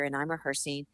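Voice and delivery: ordinary talk, nothing marked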